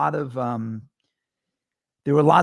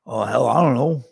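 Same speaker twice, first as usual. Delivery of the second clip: lightly voiced Jimmy, offhand shrug; a hint of Jimmy, unsure and casual; imitating Jimmy; 5 dB louder than usual. Host imitates Jimmy guessing his own animal, lightly voiced. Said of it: "and he talks like this".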